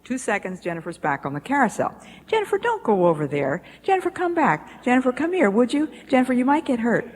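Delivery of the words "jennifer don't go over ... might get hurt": mother calling again. Retold or imitated. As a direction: plead with her to come back.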